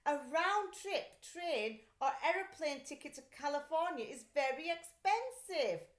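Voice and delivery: worried mother. A narrator's reading. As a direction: fuss over the cost. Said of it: "Missus Lambchop sighed".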